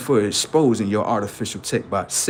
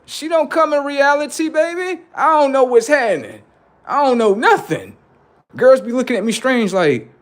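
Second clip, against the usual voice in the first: put-on high, loud bewildered old-timer; an old man dropped in the wrong era; old man character; 9 semitones higher, 5 dB louder than usual. Old-timer shocked at modern girls, loud and high.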